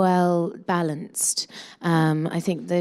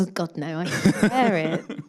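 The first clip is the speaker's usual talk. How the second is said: laughing. Real, not acted.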